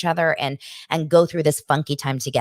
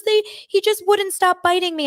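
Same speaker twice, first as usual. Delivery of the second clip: put-on very high, plaintive child voice; bawling little boy; 3 dB louder than usual. Hurt boy telling his mom about the fight, imitated bawling. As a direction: imitate an upset kid complaining to mom. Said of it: "he starts bawling".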